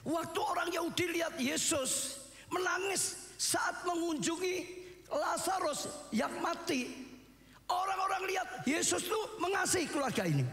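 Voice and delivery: high, ringing retelling of the story; preaching at full force. Acted. Preacher telling how Jesus wept, fervent and high. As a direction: cry the story out to the hall.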